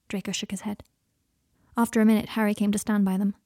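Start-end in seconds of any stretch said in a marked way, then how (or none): none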